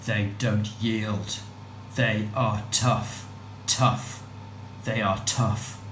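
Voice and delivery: slow, deliberate affirmation; slow mantra. Acted. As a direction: press each word down slowly.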